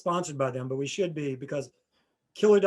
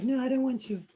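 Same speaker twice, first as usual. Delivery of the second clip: imitating [name]; 8 semitones higher than usual. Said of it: "And [name] was like".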